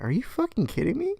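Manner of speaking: high, incredulous outburst; mock disbelief. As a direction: act stunned at the stupidity. Disbelief at lost cards.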